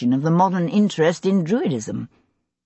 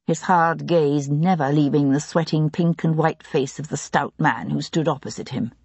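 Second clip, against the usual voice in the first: ordinary delivery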